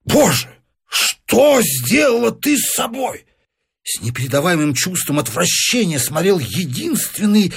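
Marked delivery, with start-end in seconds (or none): 0.1-3.2 higher, slow horrified cry; husband cries out at his wife's new body. A narrator's reading. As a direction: recoil in horror. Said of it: "вскричал бешено муж".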